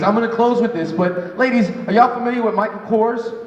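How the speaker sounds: ordinary talk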